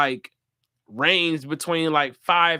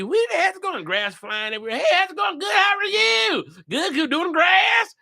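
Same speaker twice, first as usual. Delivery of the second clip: mock neighbor voices; 12 semitones higher than usual. Neighbors greeting over old mower.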